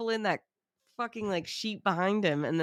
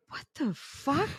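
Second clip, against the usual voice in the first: stunned, drawn-out disbelief, pitched up high; baffled; 9 semitones higher, slower than usual. Retold or imitated. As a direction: let it hang in slow disbelief. Bewildered guests react at dinner.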